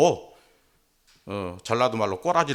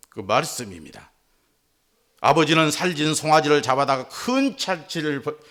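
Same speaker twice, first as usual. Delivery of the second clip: ordinary delivery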